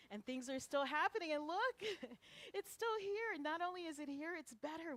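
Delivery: high, loud and exultant with a laugh; excited celebration. Real, not acted. Minister back after three months away, jubilant and loud. Celebrating the place still thriving.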